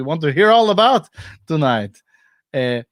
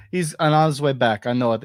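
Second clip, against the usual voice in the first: ordinary talk, nothing marked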